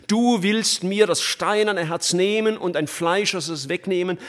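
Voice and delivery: preacher praying aloud fervently; fervent preaching. Acted. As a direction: plead with full preaching force.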